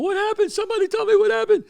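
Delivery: high, fast panicked screaming. Retold or imitated. Panicked sunbather to running people, screaming. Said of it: "I start screaming at people".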